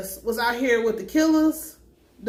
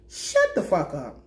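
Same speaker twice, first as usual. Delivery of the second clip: dismissive; 5 semitones lower, slower than usual. Real, not acted. Dismissing the character on screen.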